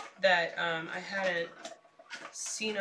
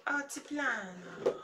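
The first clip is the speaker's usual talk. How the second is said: slow Altiplano in a put-on Italian accent; 3 dB quieter, slower than usual. Shown as a demonstration. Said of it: "I'm saying it in an Italian accent".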